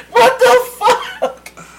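very high, slow, laughing disbelief; shocked laugh. Retold or imitated. Caught taking a stranger's bottle, high laughing shock. Realizing he grabbed the wrong bottle.

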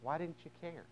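very soft, hurt question; subdued, pained plea; hushed reproach. Acted. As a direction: let the accusation out almost under the breath. Repeating the mourners' reproach.

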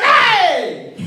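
high, loud sudden "Hey!"; African threatening shout. Acted. Preacher showing how Africans threaten, high threatening shout. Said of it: "threatening language comes with a high voice".

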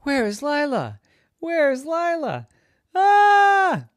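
high, loud frantic cry ending in a shriek; lost her child and panics; panicked mommy. Acted. Mother searching the maze, high frantic cry. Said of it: "Mommy turned around and said".

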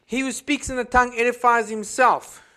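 loud, high preaching push; fiery preaching. Acted. Pastor exhorting future missionaries, loud and fiery.

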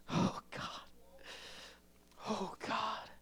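breathy whispered oh God; whispered prayer. Real, not acted. Quiet aside prayer in sermon.

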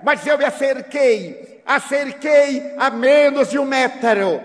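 ordinary delivery, nothing marked

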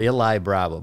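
"Eli" said as EE-lie before "Bravo"; English pronunciation. Shown as a demonstration. Guest confirming his name here, "Eli" in English pronunciation. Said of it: "Y aquí soy Eli".

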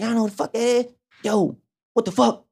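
high, astonished friend voice; friend amazed. Retold or imitated. To Ja, friend still amazed: high stunned squeal. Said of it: "He's like".

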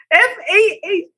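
high laugh through the name. Real, not acted. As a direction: crack up reading the cup.

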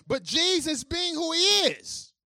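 high, loud preaching. Acted. Pastor driving home the irony to the congregation, full-force preaching.

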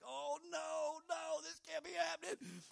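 high, mock-panicked cry; rising alarmed shout at the breakdown; alarmed dismay. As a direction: act out the panic as the smoke pours. Panicking as engine smokes.

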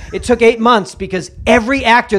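ordinary delivery

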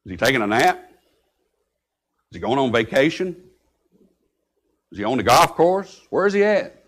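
mild taunt, pausing between jabs; mocking questions. Elijah taunting the prophets about their absent god, mocking. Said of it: "He's looking at him and said".

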